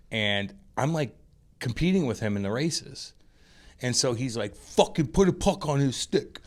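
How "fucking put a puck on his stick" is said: higher-pitched, imitating an irritated rival; mock angry yell. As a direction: act out his pissed-off barking. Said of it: "getting pissed".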